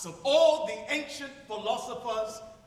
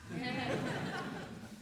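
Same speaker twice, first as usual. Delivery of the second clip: rueful laugh; 7 semitones higher, 7 dB quieter than usual. Acted.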